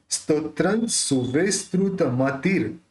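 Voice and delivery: careful syllabic Sanskrit, each syllable distinct; slow pronunciation demo. Shown as a demonstration. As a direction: slowly sound out स्तोत्रं सुविस्तृत मतिर for a student. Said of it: "ये जो रेफ है उसको ती के साथ लेना है".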